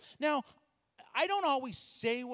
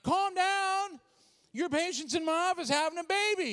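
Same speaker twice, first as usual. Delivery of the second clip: high-pitched, incredulous retort; acted indignant outburst; 7 semitones higher, 4 dB louder than usual. Pastor told to calm down by doctor, indignant outburst. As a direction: throw it back in comic outrage.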